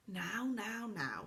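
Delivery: soft, hushing, calming an angry friend; soothing. Acted. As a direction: settle him gently like a kind fairy. Silky soothing Saucepan.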